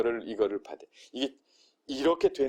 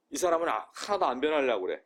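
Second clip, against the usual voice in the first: usual speaking voice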